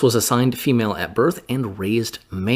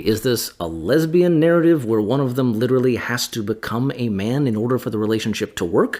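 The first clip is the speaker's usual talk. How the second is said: usual speaking voice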